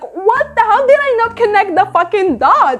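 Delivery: high-pitched mock exasperation; incredulous at self. Reviewer retelling missing an obvious connection, incredulous.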